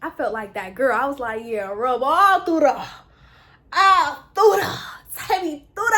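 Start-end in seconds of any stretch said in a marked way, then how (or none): 1.4-5.9 playful gushing. Acted. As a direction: stretch it out with showy delight. Acting out enjoying the hair rub.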